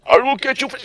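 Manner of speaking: high, put-on threat cut short; imitated tape voice. Getafix having a go at someone, high imitated voice. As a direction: launch a high-pitched tirade, then break off. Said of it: "that voice every time".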